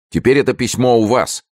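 high-pitched, insistent exclamation; urgent outcry. A narrator's reading. Pelisson protesting to the superintendent, crying out. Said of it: "вскричал Пелесон".

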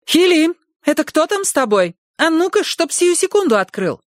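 high, scolding woman through the door; a stern housekeeper demanding entry; bossy housekeeper voice. Acted. Housekeeper Essie pounding door. (голос сердитой домработницы)

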